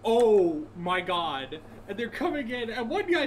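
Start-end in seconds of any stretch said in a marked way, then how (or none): 0.1-1.6 long drawn-out, higher-pitched swoon; mock ecstasy. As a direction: stretch it out in exaggerated bliss. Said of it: "having a fucking bodygasm".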